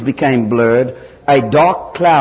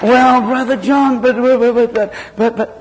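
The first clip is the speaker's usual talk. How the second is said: much higher, slow whiny churchgoer; mock a believer stalling with excuses; 9 semitones higher than usual. Preacher mimics a churchgoer whining excuses at Brother John.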